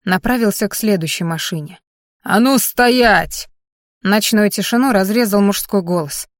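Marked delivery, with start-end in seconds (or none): 2.3-3.5 slow, loud halt order; shouted command. Acted. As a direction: hold them in place with a shout. Guard stops intruders at night.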